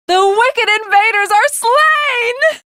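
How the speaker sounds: slow, high, proud victory cry; triumphant declaration. Acted. Triumph over the invaders.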